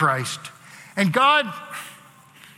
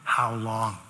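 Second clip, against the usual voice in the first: much lower and softer, fading; final quiet ask; 7 semitones lower, 5 dB quieter than usual. Shown as a demonstration. Preacher saying the final how long, low and soft. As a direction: let it die away, last time. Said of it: "prayed for the very final time".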